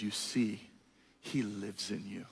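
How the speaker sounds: slow, low, soft sage voice; gentle wise sage. Retold or imitated. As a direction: hush it like a revelation. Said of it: "He says".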